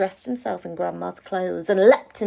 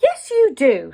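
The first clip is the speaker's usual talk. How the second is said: louder, firm mother's insistence; firm mum; 4 semitones higher, 6 dB louder than usual. A narrator's reading. Mother insisting to her daughter, firm and louder. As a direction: put your foot down as Mum.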